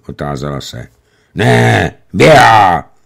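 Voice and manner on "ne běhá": grumbling sarcasm. A narrator's reading. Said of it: "zabručel Stručkov".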